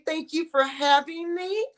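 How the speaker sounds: ordinary talk, nothing marked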